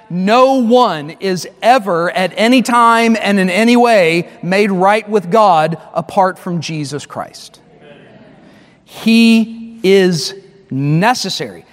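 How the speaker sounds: slow, weighty emphasis on each word; emphatic. Real, not acted. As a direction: spell it out slowly and firmly. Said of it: "let's just be really emphatic".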